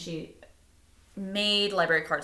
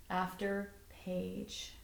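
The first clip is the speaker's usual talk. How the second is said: slow and much softer; drawn-out ending; 10 dB quieter, much slower than usual. Acted. Teacher closing the storybook, slow soft final words. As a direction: let the last words fade gently.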